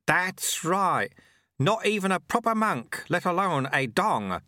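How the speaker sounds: put-on high, cheery old monk; chirpy, unbothered character voice; cheerful sweeper. A narrator's reading. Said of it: "said the sweeper cheerfully".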